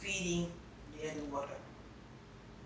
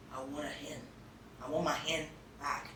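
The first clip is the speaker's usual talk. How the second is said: quiet plea; 5 semitones lower, 11 dB quieter than usual. Retold or imitated. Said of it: "she would say".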